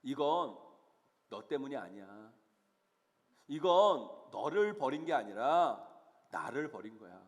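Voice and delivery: lightly voiced God, lines sinking low; slowly reassure, letting each phrase drop; God consoling Samuel. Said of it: "하나님은 사무엘에게 말씀하십니다".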